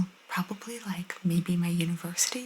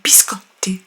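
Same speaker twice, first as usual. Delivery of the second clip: loud, punchy Italian biscotti; exaggerated Italian accent; 8 dB louder than usual. Shown as a demonstration. Showing how she won't order, overdone Italian accent. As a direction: hit biscotti hard with full Italian flair. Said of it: "I won't be like".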